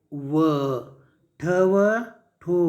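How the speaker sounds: slow, separated letter sounds; teacher slowly joining sounds; sounding out. Shown as a demonstration. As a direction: stretch each sound before blending.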